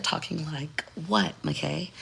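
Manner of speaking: soft, slow, carefully proper voice. Acted. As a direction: put on a proper voice, hushed and unhurried. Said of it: "trying to be, like, uh, proper".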